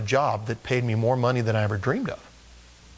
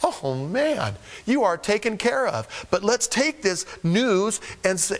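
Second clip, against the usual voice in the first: ordinary talk, nothing marked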